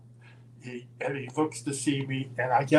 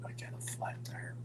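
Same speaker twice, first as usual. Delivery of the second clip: whispered confession; 16 dB quieter than usual. Retold or imitated. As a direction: whisper "I got a flat tire" sheepishly. Roddy admits the flat.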